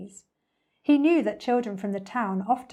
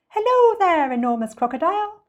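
high, lilting, louder greeting; singsong bird; 9 semitones higher, 8 dB louder than usual. A narrator's reading. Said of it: "sang the roly-poly bird".

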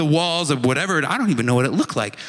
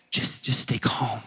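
slow hushed whisper of stay calm; 6 semitones lower, 4 dB quieter than usual. Shown as a demonstration. Pastor hushing a fearful congregation, whispering. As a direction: breathe the words out soft and slow.

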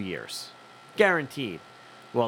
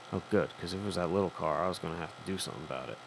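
low, much softer muttered aside; under the breath; 8 semitones lower, 9 dB quieter, faster than usual. Retold or imitated. Passenger sizing up the Uber, muttering under his breath. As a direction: mumble it to yourself, low and quiet. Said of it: "say to me under your breath".

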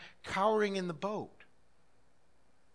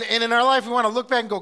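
usual speaking voice

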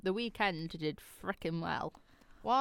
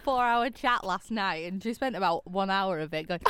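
ordinary talk

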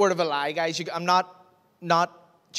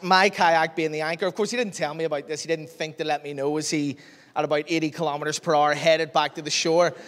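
ordinary talk, nothing marked

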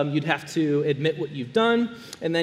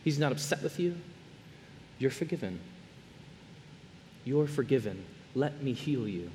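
tender Jesus; 5 semitones lower, 9 dB quieter than usual. Acted. Jesus to every listener, softly forgiving. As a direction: speak it gently, like comforting a frightened child. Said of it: "Jesus comes to you and me and he says".

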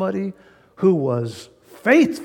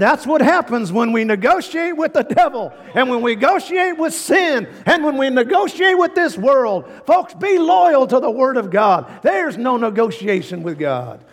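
higher, louder full-force preaching; preaching shout; 8 semitones higher, 4 dB louder than usual. Real, not acted. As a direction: drive the warning home to the congregation. Preacher drives the lesson home.

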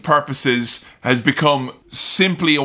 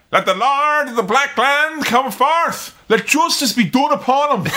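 put-on Irish-accented battle cry; 9 semitones higher than usual. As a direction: shout it out in a broad Irish accent. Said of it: "it's this Irish motherfucker".

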